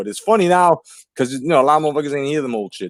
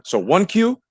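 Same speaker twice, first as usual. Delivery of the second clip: "one Q" said higher, Q punched; stress on Q; 9 semitones higher, slower than usual. Shown as a demonstration. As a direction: hit the Q sound after "one". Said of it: "What comes after one?".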